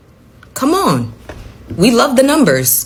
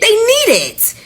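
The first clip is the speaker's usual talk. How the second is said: squeaky emphasis; 8 semitones higher than usual. Real, not acted. Emphatic rant.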